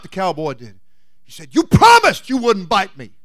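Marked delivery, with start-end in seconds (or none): 1.5-3.1 cried out. Acted. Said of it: "he cried out".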